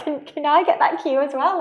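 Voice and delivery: high, eager mock coach voice; imitating another coach. Other coach in the gym asking for a breathing cue, high mock voice. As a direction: pipe up asking for the cue too. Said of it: "one of the other coaches was like".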